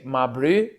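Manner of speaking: "ma bru" ending in a South African oo; South African pronunciation. Shown as a demonstration. Repeating the South African word, "ma bru" in South African accent. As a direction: say "ma bru" in place of bro. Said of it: "They say".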